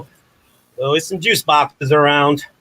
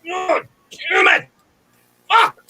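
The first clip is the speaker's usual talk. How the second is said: much higher, sudden cursing shout; real frustrated outburst; slower than usual. Real, not acted. Actor struggling with a juice box straw, real frustrated shout. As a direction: blurt it out as the straw fights back.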